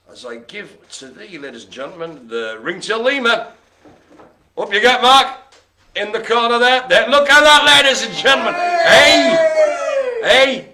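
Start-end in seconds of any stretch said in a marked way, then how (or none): 4.6-10.7 loud, high showman call, building to shouts; showman announcing. Acted. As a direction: bellow it like a circus ringmaster. Showman presenting a toy lemur.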